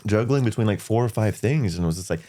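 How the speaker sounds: ordinary delivery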